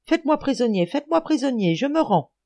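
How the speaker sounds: high-pitched frantic plea; panicked surrender cry. A narrator's reading. Schultz surrendering to an imagined enemy, panicked cry. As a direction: cry out high, begging to be captured. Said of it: "s'écria monsieur Schultz".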